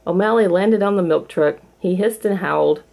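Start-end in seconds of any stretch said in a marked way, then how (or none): none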